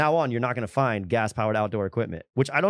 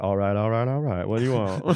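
lazy, easygoing movie-star greeting; Matthew McConaughey impression; 4 semitones lower than usual. Friend imagines the celebrity drive-thru voice, lightly laid-back. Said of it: "fucking Matthew McConaughey be like".

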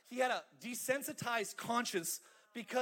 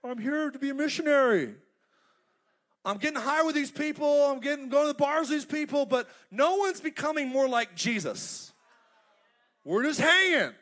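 louder casual excuse; voicing Lot; 5 semitones higher, 7 dB louder than usual. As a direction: brush it off. Lot voiced, defensive.

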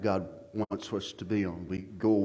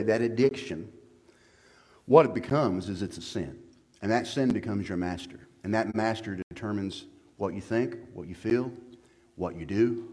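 ordinary talk

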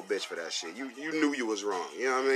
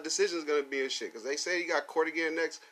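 ordinary talk

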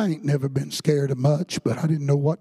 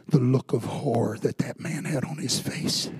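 ordinary talk, nothing marked

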